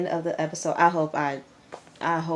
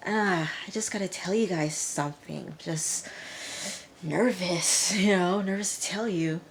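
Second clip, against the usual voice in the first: nervous Alyssa; 3 semitones higher than usual. Retold or imitated. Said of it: "Alyssa was like".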